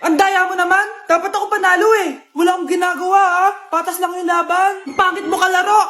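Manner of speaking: loud whiny accusations of cheating; childish angry shouting. Acted. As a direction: yell like a sore loser kid. Kids quarrel over game. (sigaw ng batang pikon)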